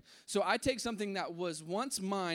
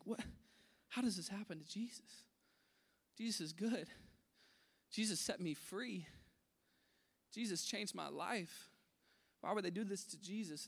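stunned and hushed; 9 dB quieter than usual. Retold or imitated. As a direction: quietly puzzle it out, still in shock. Man sitting stunned, processing news.

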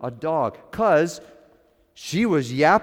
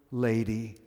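usual speaking voice

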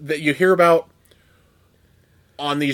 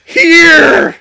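squealed high and loud; high shout; 11 dB louder than usual. Retold or imitated. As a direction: shriek the word out. Quoting Liar Liar scene.

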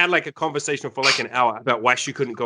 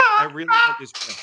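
put-on whiny girlfriend. Girlfriend voiced pleading.

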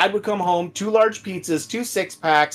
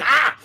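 maniac cackle; 8 semitones higher than usual. Retold or imitated. Maniac laugh while smashing.

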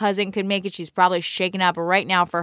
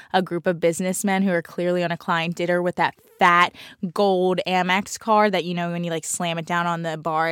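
normal talking voice

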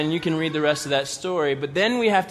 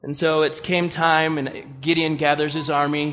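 usual speaking voice

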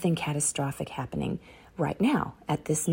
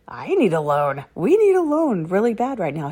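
eager plea; 4 semitones higher, 7 dB louder than usual. Retold or imitated. Husband's eager reply quoted.

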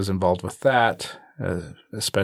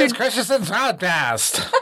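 laughing, high announcer voice; old-timey radio announcer; 13 semitones higher than usual. Pretend. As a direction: announce it grandly while laughing. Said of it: "I feel like an old-timey radio announcer".

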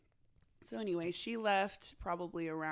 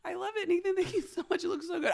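high, bright gushing praise, much higher; mock-gushing over a new hairdo; overjoyed client imitation; gushing thanks; 11 semitones higher, 5 dB louder, faster than usual. Retold gushing thanks to stylist.

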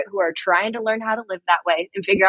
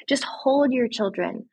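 ordinary talk, nothing marked

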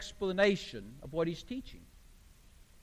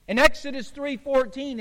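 ordinary talk

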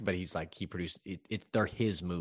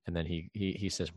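normal talking voice

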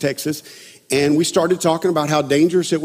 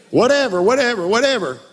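higher, dismissive repeated brush-off; mock indifference; 4 semitones higher than usual. Partner who gave up on relationship, mock indifference. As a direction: toss it off like someone who's given up. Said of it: "They don't care".

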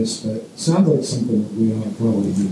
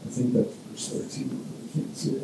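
under-the-breath aside to himself, much softer; muttered aside; 11 dB quieter than usual. Real, not acted. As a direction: mutter it while hunting for the verse. Aside while searching for verse.

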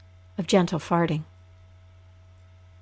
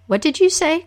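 high curious Coyote voice; a puzzled trickster asking, pitched up; trickster tale voices; 9 semitones higher, 3 dB louder than usual. Acted. Coyote asks root.

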